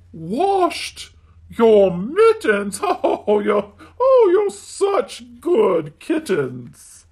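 a mother cat overjoyed; delighted mother cat. Acted. Mother cat praising kittens with laughing delight.

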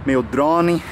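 normal talking voice

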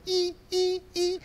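very high imitated screeching strings; horror music screech. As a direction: shriek the slasher score. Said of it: "the music's going".

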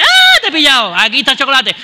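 agudo y fuerte, como vieja que pilla al niño; exclamación chillona de vieja del obrador; vieja pillada. Retold or imitated. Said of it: "y decía". (vieja exclamando, aguda y fuerte)

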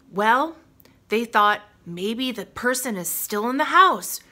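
plain reading voice

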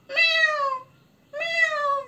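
high cat meow. Shown as a demonstration. Teacher mewing like a cat for children to guess. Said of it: "close your eyes. Just listen".

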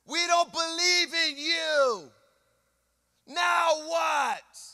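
high, slow taunting jeer; mocking crowd. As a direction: taunt him to prove himself. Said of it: "Look what they said".

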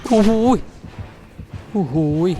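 high, loud, shocked cry; startled yelp. Real, not acted. Gamer yelping in shock as the killer strikes, loud and high. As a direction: let a sudden yelp jump out high. Reacting to chase in game.

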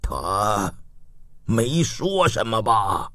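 low, soft, slow question; subdued old ruler; grieving father. A narrator's reading. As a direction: ask quietly, weighed down by grief. Grieving father asks last words.

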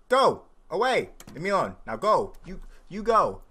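loud, punched short commands; shooing shouts. Pretend. Player ordering the bears out, loud shooing shouts. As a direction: shout each order separately.

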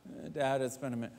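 soft, low, embarrassed mumble; sheepish son. Retold or imitated. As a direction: admit it quietly, caught out. Son answering father.

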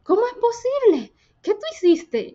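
high, alarmed gasp of a question; the landlady, spooked by the unlocked computer, cries out in high surprise; startled disbelief. Retold or imitated. Landlady reacting to the unlocked laptop, high startled disbelief. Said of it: "Se asustó y me dijo".

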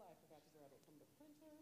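normal talking voice

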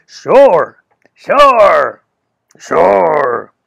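a big, loud joking lumberjack. Acted. Chafee joking to the girl, loud lumberjack voice. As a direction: boom it out like a burly lumberjack kidding around. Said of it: "in his funny voice he used for joking, a big, loud, deep imitation of a lumberjack".

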